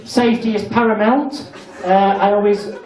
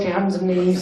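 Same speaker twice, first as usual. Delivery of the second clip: ordinary talk, nothing marked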